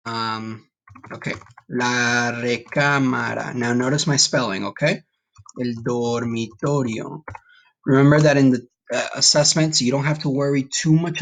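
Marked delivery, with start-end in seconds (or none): 1.7-3.5 La recámara slowed right down, each syllable clear with a rolled r; slow model word. Shown as a demonstration. Teacher modeling La recámara for students, slow and clear. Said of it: "follow along with me as I say the words". 5.6-7.2 El dormitorio drawn out syllable by syllable, rolled r; slow model word. Shown as a demonstration. Teacher modeling El dormitorio for students, slow careful. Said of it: "follow along with me as I say the words".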